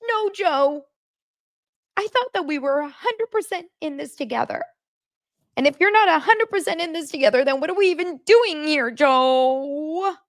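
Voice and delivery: high, exaggerated mock outrage; yelling fiancée. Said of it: "Don't yell at him".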